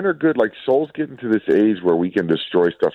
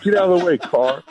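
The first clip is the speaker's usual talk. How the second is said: high mock yell with a laugh in it; raised-pitch road-rage imitation; retold driver's yell; 11 semitones higher than usual. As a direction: holler it half-laughing at another driver. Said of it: "I like to yell at drivers".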